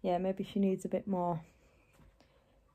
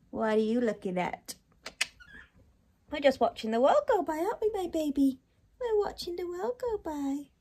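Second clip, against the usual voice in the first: higher, sing-song voice for the dog; 6 semitones higher than usual. Real, not acted. Owner coos at her dog while watching the world, doting baby talk. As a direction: coo it to a pet you adore.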